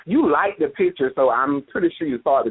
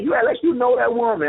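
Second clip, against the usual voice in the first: quoting sister; 9 semitones higher than usual. Retold or imitated. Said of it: "my sister be like".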